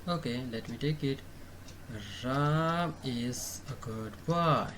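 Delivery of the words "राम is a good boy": slow, word by word dictation; a teacher dictating an example sentence. Shown as a demonstration. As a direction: spell it out slowly as you write. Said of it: "dictating while writing".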